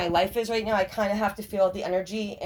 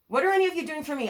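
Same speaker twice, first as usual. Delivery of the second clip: repeated faster and higher, exasperated; 6 semitones higher, faster than usual. Retold or imitated. Telling off family who never helped, exasperated. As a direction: demand it again, fed up and rushing. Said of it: "you gotta break generational curses and say".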